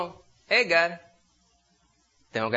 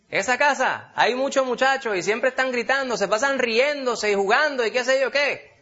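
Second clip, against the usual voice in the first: high, louder mock complaint of a sour neighbour; bitter complainer; 8 semitones higher, 4 dB louder than usual. Preacher imitating bitter neighbours complaining about the noisy house. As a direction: sneer at the noisy house next door. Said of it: "Unos amargados dicen".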